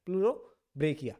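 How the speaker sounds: Pleurobrachia drawn out syllable by syllable; slow careful naming. Shown as a demonstration. Teacher repeating the name for students, slowly and carefully. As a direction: spell out Pleurobrachia slowly so it sticks.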